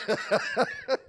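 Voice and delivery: high, real burst of laughter. Real, not acted. Preacher confesses puzzle habit to congregation, laughing. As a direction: laugh at your own confession.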